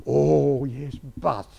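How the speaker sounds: mock gloomy "yes, but"; negative grumble. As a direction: play the grudging deacon who finds fault. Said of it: "He was so negative".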